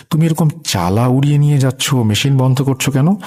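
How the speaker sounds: high-pitched indignant questioning; mildly angry, voice pitched well up. A narrator's reading. Uncle confronting the old woman over the roof, angry. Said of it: "রাগান্বিত হয়ে বললেন".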